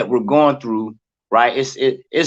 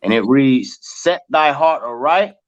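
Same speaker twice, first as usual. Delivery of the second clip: scripture reading; 3 semitones higher, slower than usual. Acted. Reads scripture aloud slowly.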